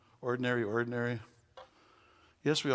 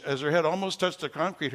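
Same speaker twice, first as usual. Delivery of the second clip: usual speaking voice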